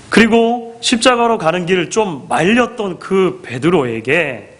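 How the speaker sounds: usual speaking voice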